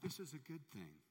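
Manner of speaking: soft, slow and peaceful; unbothered gentle faith; calm blessing. Retold or imitated. Servant to the injured king, softly serene. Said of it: "the servant said".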